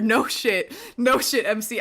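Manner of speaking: loud, high excited exclamation. Real, not acted. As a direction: blurt it out, thrilled. Excited agreement with game.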